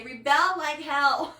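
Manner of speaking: slow, loud call to rebel, laughing; rallying cry. Real, not acted. Live host urging her viewers to rebel, loud and laughing. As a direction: urge the women to rebel, laughing. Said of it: "this is the one space where I would say".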